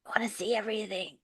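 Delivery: fast eager whisper. Acted. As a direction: breathe it out excitedly. Eager character aside.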